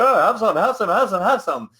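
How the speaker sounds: high, loud, eager sample-giver voice with a laugh; hammed-up supermarket sample pusher; pushy sample lady. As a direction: push the free samples on everyone, laughing. Free sample giver pushing food.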